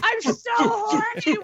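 high, loud mock cry; hammed-up wail. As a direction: wail it like a desperate villager. Acting a villager.

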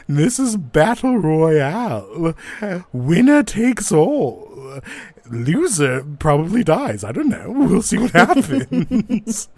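loud, high arena hype; loudspeaker announcer. Acted. Announcer counts the challengers into Battle Royale, big booming showman voice. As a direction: stretch it out like a fight announcer. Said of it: "you hear a voice over a loudspeaker".

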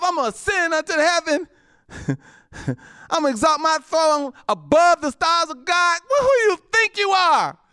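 high, loud sneering voice; mocking the devil. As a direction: ridicule the devil's boasting.

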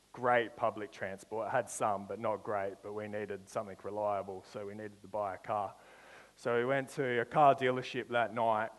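ordinary talk, nothing marked

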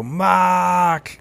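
high, drawn-out Boston "Maaahk"; wicked Boston accent. Retold or imitated. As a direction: chant it mockingly in an exaggerated Boston accent. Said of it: "he had this wicked Boston accent".

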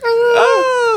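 a quick shriek of fright; short mock scream. Wife seized by the monster, mock scream.

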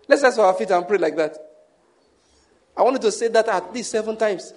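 ordinary delivery

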